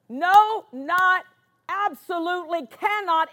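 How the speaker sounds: "No" and "not" punched high and loud, each set apart; emphatic negatives. Real, not acted. Preacher stresses the absolute negative to the congregation, "No, not" high and emphatic.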